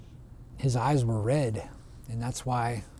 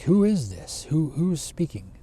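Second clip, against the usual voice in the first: raised, insistent questioning; puzzled bear; 4 semitones higher, 3 dB louder than usual. Acted. Bear asking who the hidden voice is, puzzled. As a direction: call out who's there, higher and louder.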